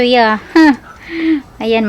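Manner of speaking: usual speaking voice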